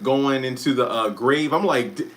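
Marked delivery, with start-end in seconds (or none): 1.9-2.1 high-pitched retold disbelief; squeaky-high emotional reaction, played up; emotional outburst. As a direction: reenact being overwhelmed, voice shooting way up. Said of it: "and I'm all emotional".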